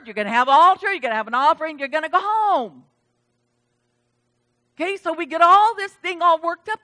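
ordinary talk, nothing marked

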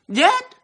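YET flung out high and loud; sudden high burst on yet; emphatic yet. Real, not acted. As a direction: hit YET like a trumpet. Preacher's sudden emphatic word.

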